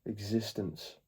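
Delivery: calm meditation guide, long pauses between phrases; guided meditation. Acted. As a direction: ease them into the present moment, unhurried.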